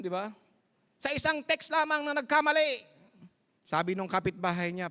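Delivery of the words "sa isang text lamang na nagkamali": higher, announcer-style news read; news anchor. Retold or imitated. Preacher imitating the TV anchor reading the headline. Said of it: "sabi niya ganon".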